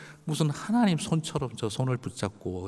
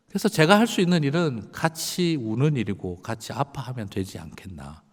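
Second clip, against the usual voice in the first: normal talking voice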